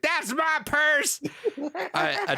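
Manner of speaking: put-on high, drawn-out boy's yelp; imitating Bobby slowly for effect; Bobby quote. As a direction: stretch it out in a high squeal. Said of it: "She's like".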